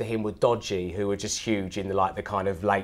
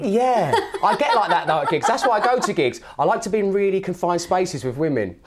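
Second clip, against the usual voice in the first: laughing; 8 semitones higher, 4 dB louder than usual. Real, not acted. Laughing off teasing.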